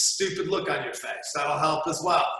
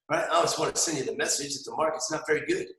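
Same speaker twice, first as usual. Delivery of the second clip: usual speaking voice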